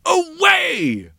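high, loud dismissive shout; royal shout. Acted. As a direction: bellow the order away. King dismisses chamberlain.

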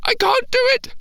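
put-on high, near-tears little boy; panicking child. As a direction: whimper it out as he starts to cry. Boy panicking alone in tent.

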